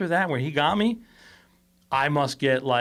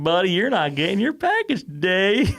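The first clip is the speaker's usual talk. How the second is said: mock driver refusal; 7 semitones higher, 4 dB louder than usual. Driver refusing to hand over package.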